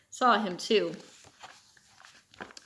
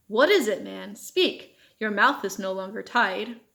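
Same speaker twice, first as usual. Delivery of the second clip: ordinary delivery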